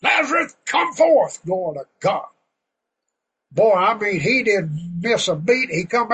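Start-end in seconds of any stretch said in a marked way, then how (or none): 0.0-1.4 high, loud call to a dead man; thundering command from the tomb's edge; commanding shout. Acted. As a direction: boom it out to raise Lazarus. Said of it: "He didn't whisper to Lazarus".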